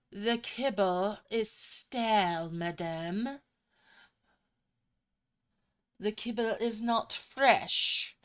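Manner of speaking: slow, haughty British accent, lightly put on; a pampered cat looking down its nose, in British English; snooty posh British cat. Pampered cat complaining to its owner about stale kibble, slow snooty British accent. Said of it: "almost a British accent. A very snooty one, very posh".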